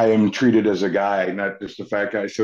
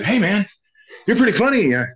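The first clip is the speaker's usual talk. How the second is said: high, loud mock director's approach; retold eager pitch, raised voice, quickening; excited pitch; 10 semitones higher, 5 dB louder, faster than usual. Director approaching a funny kid at Wendy's, excited.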